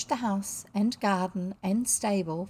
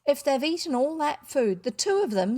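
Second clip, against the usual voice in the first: higher-pitched worried fretting; almost distraught; 6 semitones higher, 4 dB louder than usual. A narrator's reading. Mother worrying over two missing children, mildly distraught. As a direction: fret like an anxious mother. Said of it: "almost distraught".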